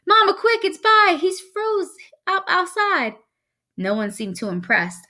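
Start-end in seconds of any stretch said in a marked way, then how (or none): 0.1-3.2 put-on high, urgent kid's cry for help; alarmed scream. A narrator's reading. Boy alerts his mother about his brother, high urgent cry. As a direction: shout the emergency in a high voice. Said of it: "I screamed".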